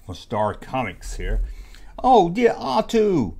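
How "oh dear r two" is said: high, slow, prissy robot butler; fussy droid voice. Acted. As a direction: whine it like a worried golden droid. Voicing C-3PO from the comic.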